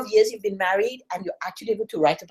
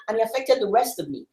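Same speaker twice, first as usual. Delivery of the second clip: ordinary delivery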